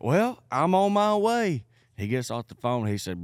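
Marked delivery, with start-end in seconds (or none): 0.0-1.7 threatening neighbor. Retold or imitated.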